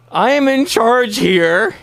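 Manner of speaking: slow, high-pitched mock shout; acted outburst. Guest answers the villain's question, mock-indignant shout. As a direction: proclaim it in a drawn-out, hammed-up yell.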